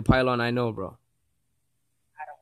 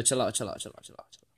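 soft, then fading to a whisper; 4 semitones lower, 14 dB quieter, faster than usual. Real, not acted. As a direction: let it die away to a whisper. Repeating chill out, fading.

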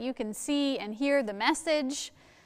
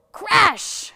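crash; 9 semitones higher, 9 dB louder than usual. Acted. Storytelling sound effect.

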